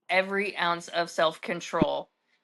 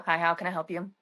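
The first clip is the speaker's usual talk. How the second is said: ordinary talk, nothing marked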